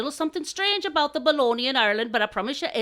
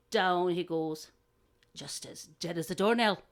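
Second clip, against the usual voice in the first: deadpan anticlimax; 5 semitones lower, 5 dB quieter than usual. A narrator's reading. Telling listeners the crow died, hushed deadpan. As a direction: let the voice sink after the build-up.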